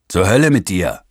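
lauter, derber Fluch über den Lärm; brüll den Fluch quer übers Gelände; Fluch gerufen. Acted. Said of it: "es wurde gerufen und geflucht". (laut gerufener Fluch)

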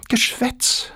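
very high whispered brush-off; a high scoff at his vows; dismissive girl. Acted. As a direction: shrug it off in a tiny whisper. Girl dismisses suitor a third time.

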